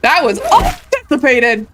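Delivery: loud startled exclamation; shocked. Real, not acted. Player rattled after a surprise hit, loud exclamation. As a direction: blurt it out, rattled.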